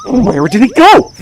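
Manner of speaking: high, loud, exasperated shouting; frustrated yell. Real, not acted. Player losing it over missing platforms, high frustrated yell.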